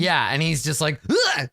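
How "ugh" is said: high, short startled "Ugh!"; hammed-up jolt of surprise; startled yelp. Game master showing Wazik's fright, high startled yelp. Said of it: "like, s-, startled".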